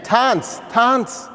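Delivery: repeated high-pitched shouted order; screamed command. Retold or imitated. Nazi guard after shooting a boy, screaming. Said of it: "He screams again".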